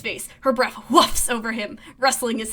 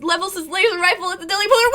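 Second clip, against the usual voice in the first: ordinary delivery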